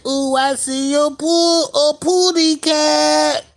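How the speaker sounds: mock cartoon bird; lightly voiced Tweety. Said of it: "Tweety Bird said".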